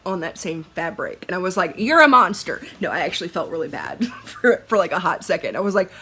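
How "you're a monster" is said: loud, higher teasing accusation; hammed-up outrage, much louder; mock accusation. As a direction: pretend to be appalled at her friend. Teasing friend who did it.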